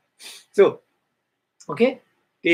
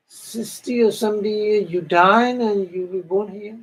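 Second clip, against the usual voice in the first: higher, louder echo in amazement; disbelieving echo; 8 semitones higher, 4 dB louder than usual. Retold or imitated. Celestial echoing her friend's lifespan, amazed and high. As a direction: repeat the number as if it's hardly a life.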